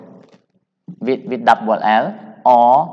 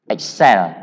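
"Excel" with the second syllable punched; stress on last syllable; 4 semitones lower than usual. Shown as a demonstration. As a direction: hit the end of "Excel", ex-CEL. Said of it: "The stress is on the last syllable".

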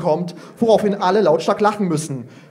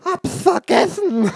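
put-on sheepish child; 11 semitones higher, slower than usual. Child forgot her question.

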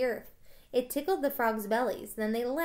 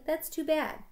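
put-on higher, mildly sad girl's line; a touch of disappointment, pitched up; sadly; 6 semitones higher than usual. A narrator's reading. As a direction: gently let down, lightly acted. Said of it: "said Annie sadly".

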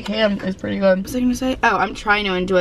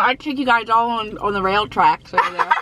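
ordinary talk

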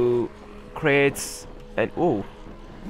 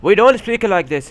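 loud, higher put-on Arabic accent; mock Arabic accent; 4 semitones higher, 5 dB louder than usual. Shown as a demonstration. Streamer objecting to the game's accents, exaggerated Arabic accent. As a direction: push the Arabic accent to protest it. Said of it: "something wrong with their accent".

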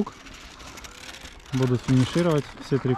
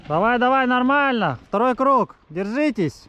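cheering; 9 semitones higher than usual. Real, not acted. Cheering riders on.

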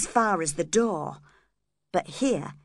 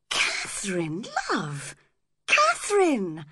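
appalled, drawn-out calling of her name; dismayed exclamation; shocked cry. A narrator's reading. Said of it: "cried Edgar, shocked".

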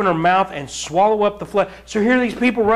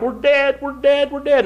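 higher panicky repeated "we're dead"; mock panic; 7 semitones higher than usual. Preacher voicing surrounded soldiers, mock panic. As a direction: play the trapped soldiers sure they'll die. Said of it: "And they're like".

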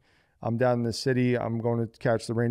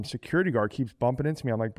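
normal talking voice

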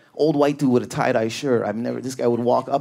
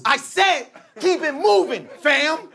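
high mock threat; menacing dude; 13 semitones higher, slower than usual. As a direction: lean on every word, menacing. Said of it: "he goes".

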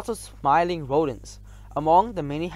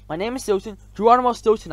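loud, higher, insistent self-introduction; firm; 6 semitones higher, 8 dB louder than usual. A narrator's reading. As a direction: plant your feet and state your name. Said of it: "I said firmly".

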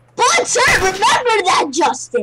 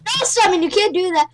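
usual speaking voice

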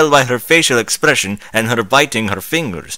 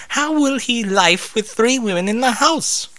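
high character voice; 13 semitones higher than usual. Acted. Rarity voiced.

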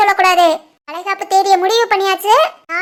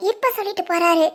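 normal talking voice

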